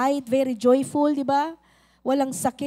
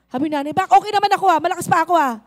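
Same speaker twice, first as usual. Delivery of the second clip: loud, higher protest that she is fine; indignant mother; 3 semitones higher, 9 dB louder, much faster than usual. Acted. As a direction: huff like a mother insulted. (galit na pagtutol ng ina)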